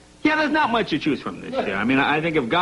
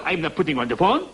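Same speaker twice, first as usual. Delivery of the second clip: imitated French accent, stubborn; lightly voiced Frenchman digging in; French man refusing. Frenchman refusing to hand over the phone, imitated French accent.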